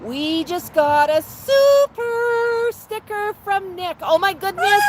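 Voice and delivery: high, sing-song coaxing voice for a parrot; pet talk. Acted. Host cooing at her parrot Max on livestream, high sing-song. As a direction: coo and coax the bird like a toddler.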